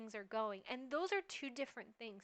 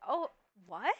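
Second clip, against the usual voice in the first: louder, offended "Oh, what?" with a rise; shocked comeback; 3 semitones lower, 8 dB louder than usual. Pretend. Wife snapping back at her husband, shocked and offended. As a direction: bristle at the husband's demand. Reacting to imagined husband's demand.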